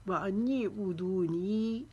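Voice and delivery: slow, drawn-out Quranic chant; Quran recitation. Shown as a demonstration. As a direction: recite slowly with tajweed, stretching the vowels. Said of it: "दू को हम एक अलिफ के जितना खींचेंगे".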